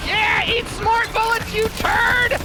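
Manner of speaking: yelled gloating taunt at enemy; taunting shout. Real, not acted. Taunting enemy mid-fight.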